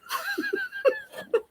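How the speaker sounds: a burst of high-pitched laughter; high, breathy laugh. Real, not acted. Laughs at the absurd slip.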